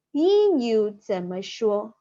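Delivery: "英语怎么说" said slowly and clearly; slow model phrase. Shown as a demonstration. Teacher slowly modelling "英语怎么说" for students. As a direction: drawl out "英语怎么说" for learners.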